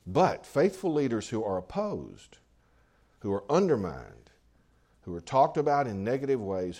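normal talking voice

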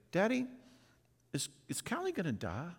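small daughter asking from the back seat; little girl's voice. Retold or imitated. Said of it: "I hear her little voice say".

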